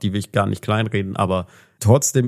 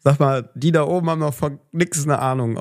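put-on Saarland accent; 4 semitones higher than usual. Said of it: "auf Saarländisch".

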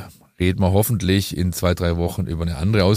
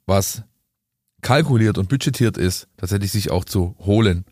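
ordinary talk

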